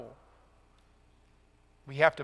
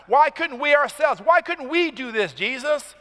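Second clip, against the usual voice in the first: higher, louder pleading "Why couldn't we ourselves?"; emphatic disciples; 7 semitones higher, 4 dB louder than usual. Acted. Disciples asking Jesus, higher emphatic complaint. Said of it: "It's emphasized".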